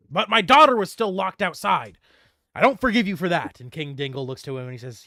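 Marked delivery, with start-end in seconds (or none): 0.1-3.6 louder, higher grudge; wronged father's indignation; stern anger. Acted. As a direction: refuse forgiveness firmly. King angry about daughter.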